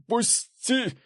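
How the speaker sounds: very high strained screech without much volume; thin, frantic shriek; panicked squeal. A narrator's reading. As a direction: squeal to be let go. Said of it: "верещал Цепляев".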